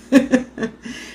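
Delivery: short high chuckle; amused laugh at yourself. Real, not acted. Host recalling how she forgot the day, chuckling.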